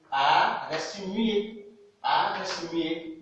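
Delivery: A reste muet, dit lentement; slow emphasis. Shown as a demonstration. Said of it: "Regardez bien". (A reste muet, très lent)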